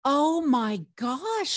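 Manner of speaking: high, drawn-out gasp of amazement; amazed. Real, not acted. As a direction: stretch it out, high-pitched and awed. Amazed reaction to the cards.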